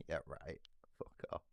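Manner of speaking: ordinary talk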